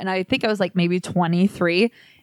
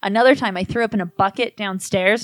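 ordinary talk

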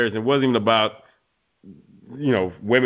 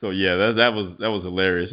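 normal talking voice

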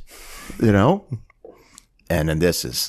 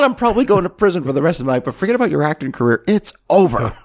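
high, quick rant rising to a louder finish; mock furious tirade; 9 semitones higher, 4 dB louder, faster than usual. Producer telling off his assistant in front of police, mock fury. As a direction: play a boss ending a career, hammed up. Acting Lee berating Elliot.

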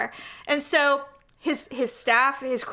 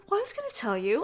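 imitating a sheepish, softened aside; under his breath; 6 dB quieter, faster than usual. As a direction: mumble it softly like a caught-out dentist. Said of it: "below his breath".

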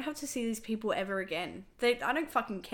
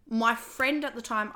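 ordinary talk

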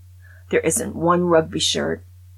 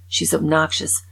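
normal talking voice

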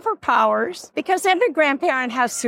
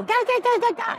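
put-on high cooing baby talk; 10 semitones higher, faster than usual. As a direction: coo at a newborn. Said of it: "everybody goes up and they go".

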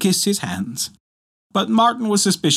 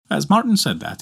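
ordinary delivery

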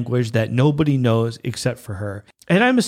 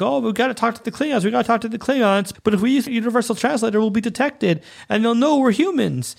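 higher, rushed mock fluster; mock panicked crew; 8 semitones higher, faster than usual. Retelling the crew near the Klingons, mock panic.

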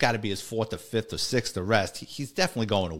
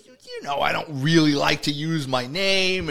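Tiny's sheepish excuse; 4 semitones higher than usual. Retold or imitated. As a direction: stretch out a lame excuse in a raised voice. Caught informant excuses his false name.

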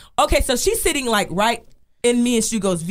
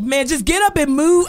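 mock yell, higher and drawn out; retold heckler; 6 semitones higher, 3 dB louder than usual. Heckler in the front tells her to move, impatient. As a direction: call it out like an impatient passenger.

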